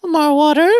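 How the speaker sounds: high, hammed-up questioning voice; comic waiter with pitch shot up; waiter impression. Waiter coming back to the table, hammed-up high voice. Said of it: "and he's like".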